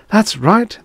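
high, excited cry; narrated scream, slightly restrained. A narrator's reading. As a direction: pitch shoots up, exultant. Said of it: "the voice within his head screamed".

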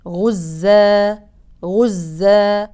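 غزا ending on a drawn alif, very slow; pausal madd. Shown as a demonstration. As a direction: stop on غزا with a long a. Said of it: "يكون مد عوض". (مد عوض)

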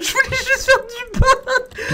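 slow, high, half laughing half crying. Real, not acted. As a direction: whimper it through laughter. Laughing despair after mistake.

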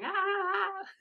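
high panicked "Ah!" of a freaked-out nervous system; playful mock alarm cry; mock panicked shriek. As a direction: squeal like you're freaking out. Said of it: "nervous system freaking out".

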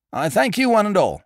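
high, dropping H's in his nerves; nervous announcer. Acted. Flustered compère explaining the star's accident to a hostile house. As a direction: gabble it out, flustered, edging off stage.

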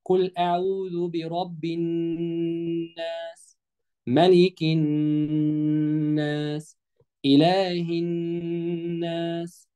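slow, level-toned tajweed chant; measured, drawn-out model recitation; Quran recitation. Shown as a demonstration. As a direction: recite with long vowels and hummed nn. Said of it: "هقرأ السورة مرة تانية".

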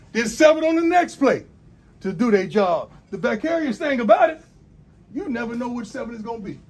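pep speech. Real, not acted. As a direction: fire the players up, building. Coach fires up team.

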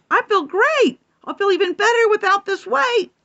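high, quick kitten voice; kitty voice. Acted. Kitty cat telling the others in a kitten voice. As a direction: chirp it fast like a little cat. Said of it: "Kitty cat replied".

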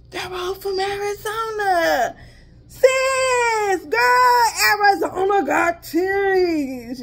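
gasp, then high, slow, excited call-out; thrilled, like a big discovery. Real, not acted. Telling sis all the teas are from Arizona, excitedly.

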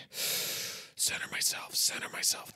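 slow, breath-only whisper; self-calming whisper. Pretend. Podcaster centering himself before resuming the show, whispered mantra. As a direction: whisper it like a mantra to regain control.

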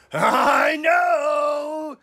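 loud, high drawn-out shout; eager duck. Acted. Storyteller voicing Duck shouting his idea, excited. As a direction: burst out with the answer.

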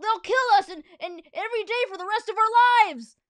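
high, loud panicked pleading; begging. Acted. Harold begs the principal, high panicked pleading. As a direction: beg for your life. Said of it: "begged Harold".

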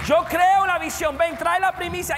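rally the church to come forward, shouting; full-force preaching. Acted. Altar call at full force.

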